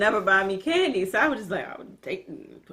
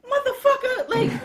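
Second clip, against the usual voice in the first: grandma yelling. Retold or imitated. Grandma voiced in a retold story.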